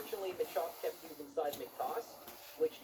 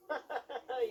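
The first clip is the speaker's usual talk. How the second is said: short laugh; 6 semitones higher than usual. Real, not acted. Laughs at own failed attempt.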